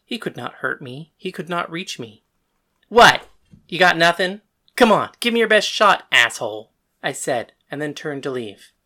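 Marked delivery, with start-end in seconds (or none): 2.9-6.7 loud, goading challenge; defiant taunt. Acted. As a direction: goad him to hit you. Taunting chained father.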